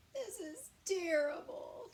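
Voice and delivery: high, loud, slow moan. Acted. Crying bear moaning to himself about his grumpiness. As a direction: wail it like a weepy bear. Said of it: "he moaned".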